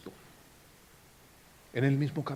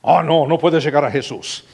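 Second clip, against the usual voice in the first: gatekeeping scold; 10 dB louder than usual. Pretend. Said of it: "en vez de jugar de policía".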